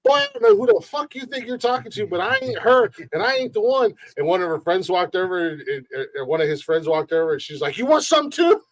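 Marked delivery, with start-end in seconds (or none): 0.0-3.9 very high, sassy Southern woman; country Southern accent. Retold or imitated. Southern woman squaring up to a drunk man, mock indignant Southern accent. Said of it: "she's got a little country-ass accent, southern accent". 7.8-8.6 high-pitched Southern challenge; country Southern accent. Retold or imitated. Southern woman challenging the man's friend, mock feisty Southern accent. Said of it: "she's got a little country-ass accent, southern accent".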